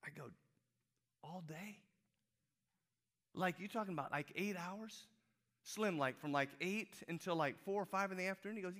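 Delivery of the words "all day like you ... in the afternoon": incredulous. Retold or imitated. Acting out his doubtful reply.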